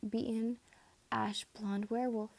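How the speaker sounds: usual speaking voice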